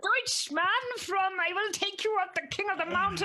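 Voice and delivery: high, strained grunting voice; straining cyclist voice. Retold or imitated. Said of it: "grunting in there going".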